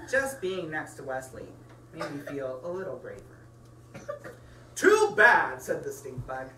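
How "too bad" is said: pompous bug. Acted. Stink bug dismissing bunnies.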